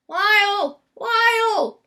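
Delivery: high, loud call across a crowded store; crying out. A narrator's reading. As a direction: call his name out loud from far off. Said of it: "the voice cried out".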